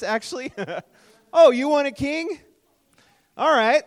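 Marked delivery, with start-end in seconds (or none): 1.3-3.8 sarcastic God voice. Acted. Pastor voicing God, sarcastic.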